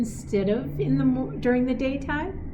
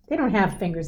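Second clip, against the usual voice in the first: ordinary delivery, nothing marked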